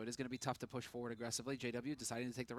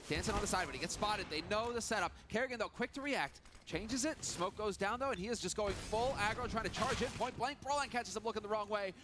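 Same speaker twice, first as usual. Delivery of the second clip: excited; 8 semitones higher, 5 dB louder than usual. Real, not acted. Rising hype during the round.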